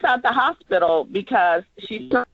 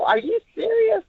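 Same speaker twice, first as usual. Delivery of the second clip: incredulous; 13 semitones higher than usual. Shown as a demonstration. Director demos the nurse's reaction to the memo, incredulous. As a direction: pitch jumps high, stunned disbelief. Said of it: "It's like you're incredulous".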